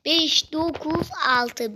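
"Beş dokuz altı" drawn out word by word; "Beş dokuz altı" at half speed, deliberately; slow spelling-out. Shown as a demonstration. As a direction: read "Beş dokuz altı" very slowly, each number stretched. Spells code out slowly for viewers.

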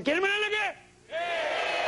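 high shouting game-show host; hammed-up party host yelling at the crowd; shrill host.

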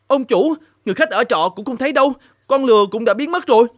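high-pitched servant calling out to his master; a worried servant, voice up high; frantic servant. Acted. The servant calls his master about the runaway daughter, high and anxious.

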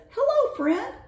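high-pitched welcome; high storybook-bear hello; bear's greeting. A narrator's reading. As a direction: greet a new friend up high. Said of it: "Bear says".

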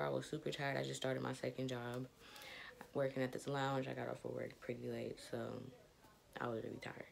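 normal talking voice

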